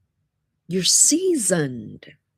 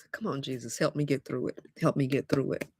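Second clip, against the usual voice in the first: much softer, murmured prayer; quiet prayer; 3 semitones lower, 8 dB quieter than usual. Real, not acted. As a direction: pray under your breath.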